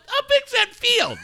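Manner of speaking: very high, loud, cracking-up question; retold amazement through laughter; laughing disbelief. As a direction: squeal it out, tickled and incredulous. Retelling reaction, breaking up laughing.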